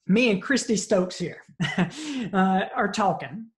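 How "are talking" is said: normal talking voice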